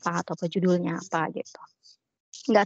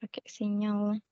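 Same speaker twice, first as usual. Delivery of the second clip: breaking up; 2 semitones higher, 8 dB quieter, slower than usual. Real, not acted. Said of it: "di saya putus-putus".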